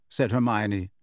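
plain reading voice

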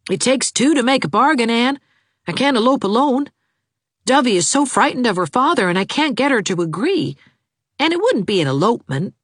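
lightly voiced eager young man; a hint of a lovesick young suitor, pleading. A narrator's reading. Jarvis's voice in the story.

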